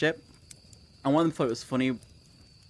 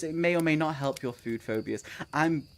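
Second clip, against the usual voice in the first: usual speaking voice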